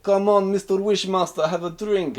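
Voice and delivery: high, loud tipsy Russian coaxing; drunk mock Russian. Drunk Krichkov urging the Wishmaster to drink. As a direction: wheedle him like a drunk pal.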